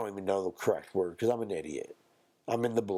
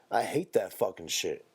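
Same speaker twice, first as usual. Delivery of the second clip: normal talking voice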